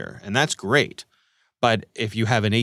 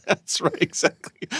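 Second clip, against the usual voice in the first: laughing; 7 semitones higher than usual. Real, not acted. Laughing at the scam joke.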